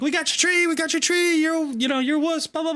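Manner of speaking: very high squeaky crow voice; high mock crow chant at the hawk; taunting crows. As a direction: tease like a gang of cocky crows. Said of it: "and they were like".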